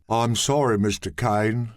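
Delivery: flat, dreary, unchanging delivery; bored monotone apology. Acted. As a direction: drone it out with no lift. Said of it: "dreary, monotone voice".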